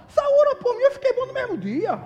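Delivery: very high, loud retold proclamation; exuberant testimony. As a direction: proclaim it like an overjoyed witness. Retold public testimony of healing.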